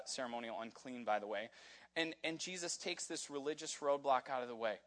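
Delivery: normal talking voice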